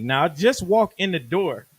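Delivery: ordinary talk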